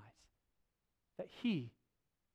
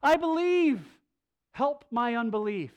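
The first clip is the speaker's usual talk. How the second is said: loud, high, desperate cry; father crying out to Jesus; 6 semitones higher, 9 dB louder than usual. Retold or imitated. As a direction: cry it out in anguish. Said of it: "cries out".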